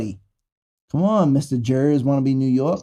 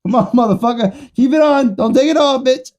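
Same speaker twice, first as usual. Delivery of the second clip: taunting; 7 semitones higher, 5 dB louder than usual. Acted. Taunting someone loudly.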